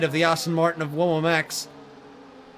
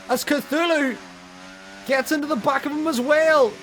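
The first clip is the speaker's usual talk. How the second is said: raised, excited race call; motorsport commentator; 9 semitones higher than usual. Real, not acted. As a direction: call a wild five-way battle. Close multi-car battle call.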